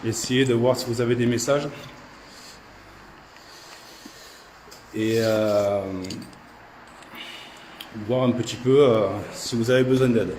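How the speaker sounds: loud, measured address to unseen spirits; calling to spirits. Real, not acted. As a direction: call out peaceably to whoever is there. S'adresse aux esprits à voix haute. (appel aux esprits à voix forte)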